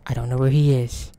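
normal talking voice

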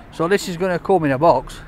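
ordinary delivery

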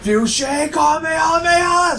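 high, slow drawn-out battle cry; high slow hammed-up attack yell; anime attack call. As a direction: stretch the attack name like an anime hero. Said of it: "He's like".

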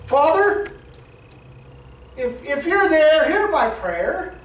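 slow, hesitant plea that trails off; halting, doubting prayer, drawn out at the end; doubtful prayer. Shown as a demonstration. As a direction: pray as if unsure anyone is listening. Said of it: "We can't come to the Lord like that".

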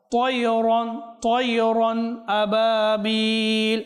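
slow, melodic tajweed chant; slow chanted Quranic recitation. Shown as a demonstration. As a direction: draw out each Arabic syllable in measured recitation.

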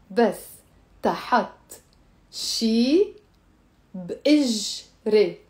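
word by word, drawn out; spaced out for students to repeat; slow. Shown as a demonstration. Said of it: "Let me say it slow".

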